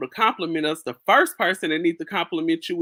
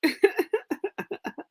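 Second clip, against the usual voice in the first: high squealing laugh; 14 semitones higher than usual. Real, not acted. Laughing mid-quote.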